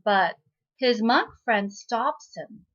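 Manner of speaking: usual speaking voice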